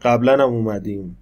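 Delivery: ordinary talk, nothing marked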